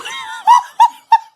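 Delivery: high loud shriek of laughter; cracking up hard; loud burst of laughter. Real, not acted. Reacts to the video story.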